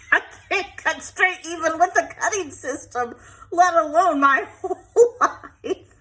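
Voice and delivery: high, loud, laughing through the words; real laughter breaking into speech; laughing self-mockery. Real, not acted. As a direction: crack up at your own clumsiness. Laughing at her own cutting.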